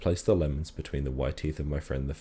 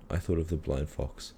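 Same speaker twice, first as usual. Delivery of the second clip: ordinary delivery, nothing marked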